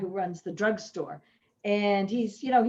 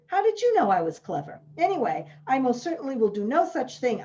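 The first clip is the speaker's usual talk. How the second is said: snapping; 4 semitones higher, 5 dB louder than usual. Acted. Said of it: "Jessica snapped".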